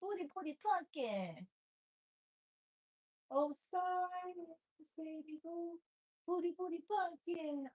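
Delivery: high, sing-song pet voice. Real, not acted. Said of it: "I talk like that 'cause he likes when I make the funny sounds".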